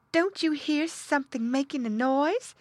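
high-pitched mother pig asking. A narrator's reading. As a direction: ask her husband in a high voice. Mother pig figure asks her husband.

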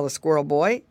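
usual speaking voice

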